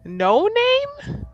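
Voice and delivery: high, loud, rising question; incredulous. Real, not acted. Player baffled by the bar with No Name, incredulous. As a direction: ask it in real disbelief, pitch shooting up.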